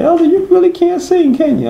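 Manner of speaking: high, mocking voice of a laughing young woman; teasing young woman. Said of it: "She's like".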